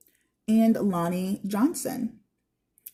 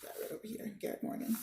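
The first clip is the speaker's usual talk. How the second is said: much softer whispered aside; 14 dB quieter, faster than usual. Real, not acted. Teacher muttering to herself while finding the page, whispered. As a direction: drop to a whisper.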